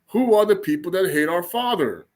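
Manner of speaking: ordinary talk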